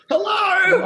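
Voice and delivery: high, disbelieving hello; can anyone hear me; incredulous. Retold or imitated. Ignored onstage by snake handlers.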